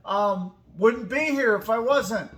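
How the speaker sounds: ordinary talk, nothing marked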